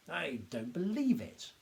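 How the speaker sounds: slow, stunned cry of disbelief; can't believe what he hears; cried out in disbelief. A narrator's reading. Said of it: "he cried".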